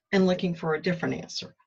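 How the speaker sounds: ordinary delivery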